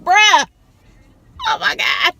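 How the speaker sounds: high, loud "Bruh, oh my God"; stunned shout. Retold or imitated. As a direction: blurt it out in shock. Reaction to dead husband visiting.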